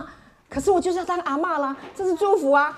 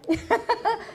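a quick high giggle; playful self-amused laugh; 8 semitones higher, 4 dB quieter than usual. Real, not acted. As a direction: giggle at your own vanity. Laughs at own joke.

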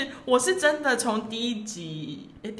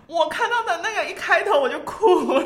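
laughing confession; 8 semitones higher, 4 dB louder than usual. Real, not acted. Admitting she cried, laughing.

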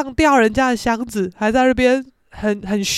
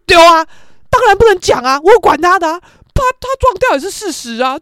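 loud, raised-pitch self-justification; mock defiant mother; imitated brazen insistence; 6 semitones higher, 8 dB louder than usual. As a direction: brush off the objection with bluster.

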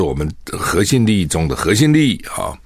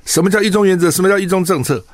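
mock quizzing student, pitched up high; student's challenging question; 11 semitones higher, 3 dB louder than usual. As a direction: fire the question like a student testing him. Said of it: "学生就问他".

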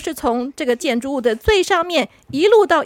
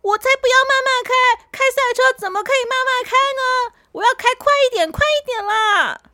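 much higher, fast, insistent demand; little race car begging to go faster; impatient car; 11 semitones higher than usual. Acted. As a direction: demand speed like an eager kid. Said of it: "小扑就有意见了".

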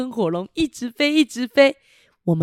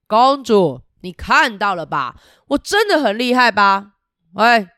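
loud, bragging dragon voice; boastful dragon; 2 semitones higher, 6 dB louder than usual. Acted. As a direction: brag like a proud dragon to the kids. Dragon voiced in picture book. (得意洋洋的喷火龙)

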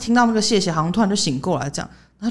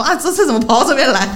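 police quote through laughter; 6 semitones higher, 5 dB louder than usual. Retold or imitated. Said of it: "就那个警察就说".

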